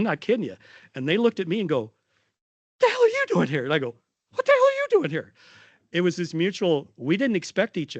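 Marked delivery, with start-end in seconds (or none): 2.8-3.6 much higher, louder cry of happy disbelief; a Nebraska nurse greets the speaker in surprise, high and loud; surprised exclamation. Retold or imitated. 4.3-5.3 very high, loud, quick echo of delighted shock; the speaker answers the Nebraska nurses with an even higher, faster cry of surprise; surprised exclamation back. Retold or imitated. Speaker echoes the surprised greeting back.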